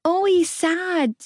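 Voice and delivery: put-on high, slow toddler voice; lisping little boy. A narrator's reading. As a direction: speak like a small child.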